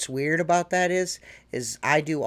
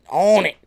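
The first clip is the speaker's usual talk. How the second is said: "on it" punched louder and higher; emphatic repeat; 4 semitones higher, 8 dB louder than usual. Real, not acted. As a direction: hammer "on it" home with force.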